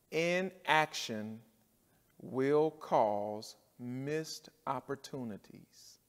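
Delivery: very slow, word by word; slow emphatic. Shown as a demonstration. Preacher stating point three to the church, very slow and deliberate. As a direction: lay each word down slowly. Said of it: "Let me say it".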